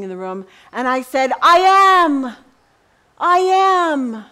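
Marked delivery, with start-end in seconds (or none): 1.4-4.2 high, loud declaration; bold proclamation. Retold or imitated. Preacher proclaiming God's name to the inmates, high and loud. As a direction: announce it boldly to the room.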